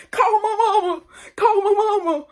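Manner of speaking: very high, loud screeching wail; mock screaming for mama, half laughing; imitating a drunk scream. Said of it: "Loco is screaming".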